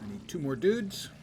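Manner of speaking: usual speaking voice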